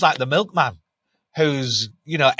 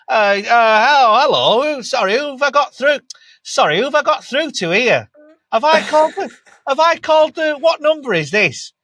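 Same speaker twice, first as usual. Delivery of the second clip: higher, louder, bumbling thick-lad voice; daft lad with a cranked-up accent; 10 semitones higher, 7 dB louder than usual. Retold or imitated. Cold caller putting on a dim persona to charm the receptionist, high and loud. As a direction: play the clueless caller to get past the gatekeeper. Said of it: "crank up the accent and I, you know, pretend I was a bit of a div".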